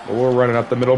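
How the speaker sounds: ordinary delivery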